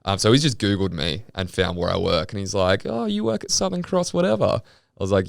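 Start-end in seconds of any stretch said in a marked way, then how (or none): 2.9-4.4 high-pitched mocking imitation; imitated removalist. Retelling the removalist who googled him, mocking imitation. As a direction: put on his needling voice, pitched right up. Voicing removalist sneering about his workplace.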